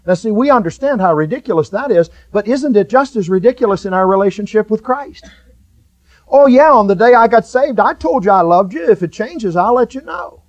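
loud, high mock believer in the sermon; full-force preaching. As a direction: preach it, mocking the lazy believer.

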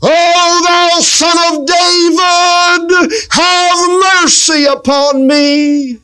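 high, slow, drawn-out pleading wail; desperate high wail of a blind beggar; crying out. Retold or imitated. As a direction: stretch every word out like a beggar begging to be heard. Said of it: "Bartimaeus would cry out".

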